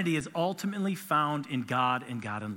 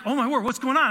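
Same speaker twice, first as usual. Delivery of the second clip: alarmed; 7 semitones higher, 7 dB louder, faster than usual. Retold or imitated. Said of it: "And I'm like".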